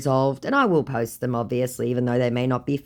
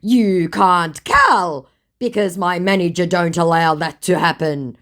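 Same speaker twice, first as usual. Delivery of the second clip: imitating the scammer; 4 semitones higher, 6 dB louder than usual. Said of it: "my Mughal goes".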